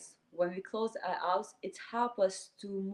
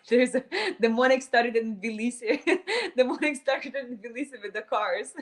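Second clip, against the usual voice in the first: loud, higher-pitched talk through real laughter; laughing; 4 semitones higher, 8 dB louder than usual. Real, not acted. Meditation guide apologising to the group for morning traffic noise, laughing. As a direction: crack up while talking.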